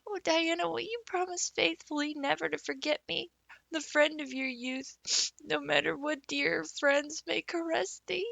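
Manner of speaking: high romantic plea with a sniff; a girl swearing eternal friendship; heartfelt appeal to her best friend; dramatic girl pleading. A narrator's reading.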